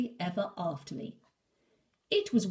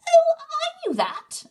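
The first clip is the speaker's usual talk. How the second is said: much higher and much louder, insisting; squeaky boast; 15 dB louder than usual. Acted. Little Wolf bluffing to Big Wolf, high and loud. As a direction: yelp it like a small pup showing off.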